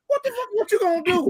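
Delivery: high squeaky what the fuck; high-pitched mock panic at a friend; shocked disbelief. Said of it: "And I'm looking like".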